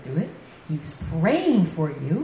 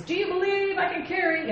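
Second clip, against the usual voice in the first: high-pitched mock French accent; 11 semitones higher than usual. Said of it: "in a really good French accent".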